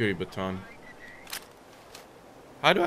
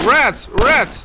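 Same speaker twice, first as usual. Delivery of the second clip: much higher, louder yelled warning; alarmed shout; 12 dB louder than usual. Real, not acted. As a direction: yell it out in fright. Spotting rats.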